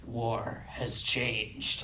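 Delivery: very slow, low Snake growl. Retold or imitated. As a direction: drag it out like Solid Snake. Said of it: "and then Snake goes".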